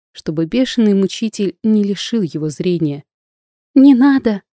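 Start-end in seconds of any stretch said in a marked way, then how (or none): 3.7-4.4 high, loud plea in fright; frightened cry. A narrator's reading. Natasha watching Mikhail being whipped, frightened cry. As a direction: cry out in alarm to stop it. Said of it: "закричала испуганно Наташа".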